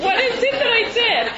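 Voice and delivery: high, loud, laughing question; curious. Retold or imitated. To her angry dad, laughing and curious. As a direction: ask genuinely curious through a laugh. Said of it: "I was really curious".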